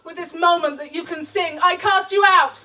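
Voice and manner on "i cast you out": high, loud rallying shout; rousing call to the audience. Acted. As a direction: yell the spell to the crowd.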